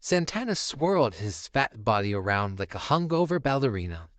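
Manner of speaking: plain reading voice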